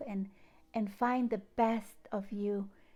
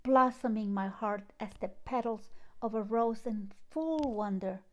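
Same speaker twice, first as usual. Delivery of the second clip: louder, declaimed poetry reading with long pauses; dramatic reading of her own poem, louder throughout; poem recital; 5 dB louder than usual. Acted. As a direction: proclaim each line like a sunrise call to the heart. Reads her poem aloud.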